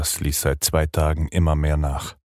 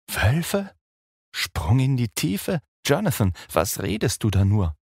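ungläubig; 9 semitones higher than usual. Acted. Mina fassungslos über Jonathans Worte.

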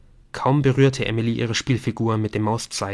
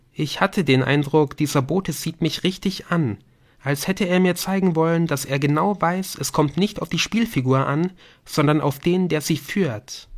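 higher-pitched voice for Emily, impressed by the game; Emily, aufgeregte Spielerin; 6 semitones higher than usual. Acted. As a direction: speak higher, caught up in wonder. (höhere Stimme einer beeindruckten Spielerin)

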